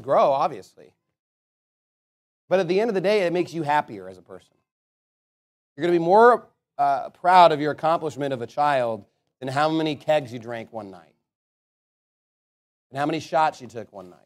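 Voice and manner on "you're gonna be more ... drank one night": ordinary talk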